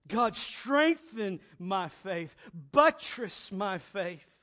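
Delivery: slow pleading prayer cry; fervent prayer. Acted. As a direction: ask God earnestly. Prayer of the heart.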